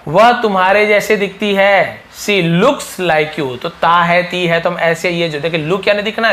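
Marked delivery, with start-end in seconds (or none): 2.0-3.6 "looks" stretched out very slowly; slow, clear teacher demo; slow model reading. Shown as a demonstration. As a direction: drag each word for the class. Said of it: "teacher models sentence slowly".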